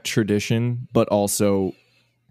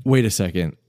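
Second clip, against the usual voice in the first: ordinary talk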